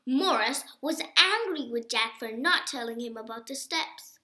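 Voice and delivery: usual speaking voice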